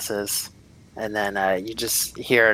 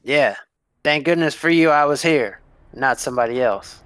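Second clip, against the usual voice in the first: lightly voiced guard, somewhat higher; scolding guard; 5 semitones higher, 4 dB louder than usual. Guard lectures visitor then asks about investment, raised character voice. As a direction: put a raised, reproving edge on the guard.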